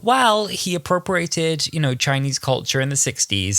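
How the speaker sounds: mock the defensive excuse with a hint of sneer; cynical mock voice. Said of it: "if I was being really cynical".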